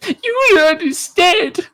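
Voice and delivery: very high, slow, whimpering mock; mock crying cousin. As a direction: wail it like the sobbing cousin, drawn out. Crying cousin behind door.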